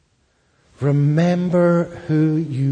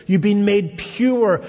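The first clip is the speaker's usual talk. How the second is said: ordinary talk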